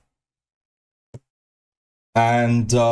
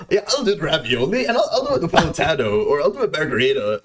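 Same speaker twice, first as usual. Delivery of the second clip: imitated Aiden; 4 semitones higher than usual. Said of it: "Who fucking speaks like that?".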